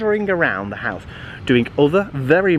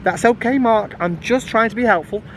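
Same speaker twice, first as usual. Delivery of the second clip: pointed reply; 7 semitones higher than usual. A narrator's reading. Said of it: "glancing at Mum and Dad to make a point".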